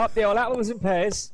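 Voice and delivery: loud, high Cockney geezer banter; Cockney accent. Acted. Guest shows off to the host, rowdy Cockney accent.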